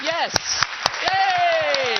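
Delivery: loud, high, drawn-out cheer. Real, not acted. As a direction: whoop for the volunteers over applause. Cheering the volunteers.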